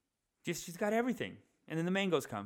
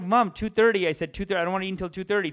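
raised, higher insisting voice; louder, higher protest to mom; exasperated insistence; 6 semitones higher, 10 dB louder, much faster than usual. Retold or imitated. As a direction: repeat the time, fed up. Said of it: "I'm like".

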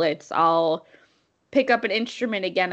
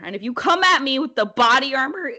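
loud, raised mock threat with voice giving out; voice slipping; 5 semitones higher, 5 dB louder than usual. Real, not acted. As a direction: let the voice slip while getting worked up. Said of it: "oh my God, my voice".

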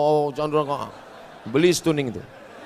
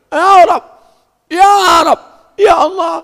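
desperate pleading prayer; 13 semitones higher, 8 dB louder than usual. Acted. Pleading traveler in hadith.

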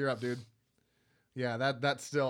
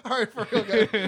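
laughing; 7 semitones higher, 11 dB louder, slower than usual. Real, not acted. Laughing wrap-up.

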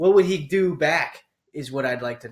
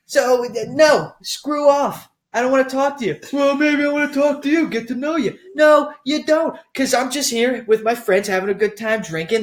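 hammed-up argument; 8 semitones higher than usual. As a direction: act out both sides of a bar spat. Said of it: "this rant".

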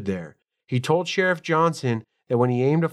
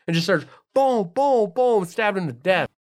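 much higher, laughing, rushing to tell it; gushing with laughter over a crazy movie scene; excited raving; 7 semitones higher than usual. Real, not acted. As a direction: podcaster raving to friends about the mom's rampage, excited. Gushing about the Gremlins mom.

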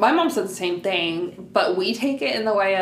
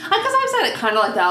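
laughing; 11 semitones higher, 4 dB louder, faster than usual. Real, not acted. Laughing while speaking.

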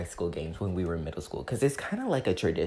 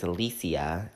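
ordinary delivery

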